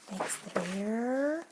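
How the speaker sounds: drawn out, voice climbing; slow rising suspense. Acted. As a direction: stretch it to build suspense. Second take, rising suspense.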